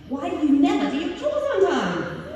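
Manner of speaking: high, loud British scolding; British accent. Shown as a demonstration. Mother scolding children about chores, British accent. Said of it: "in a British accent".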